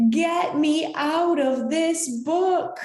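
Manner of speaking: high panicked scream of a scared bookmark. A narrator's reading. Scared bookmark screams to get out of the book. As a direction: shriek to be let out. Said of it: "and I screamed".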